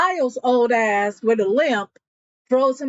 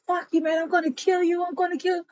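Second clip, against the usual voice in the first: higher, heated retold threat; mock furious threat; acted threat; 8 semitones higher, much faster than usual. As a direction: voice the gunman losing it. Threatening at gunpoint.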